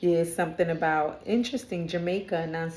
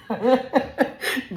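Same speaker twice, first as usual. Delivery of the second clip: higher, louder burst of laughter; real laugh; 5 semitones higher, 5 dB louder than usual. Real, not acted. Reader losing it while scolding her pet, real laugh. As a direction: crack up mid-command.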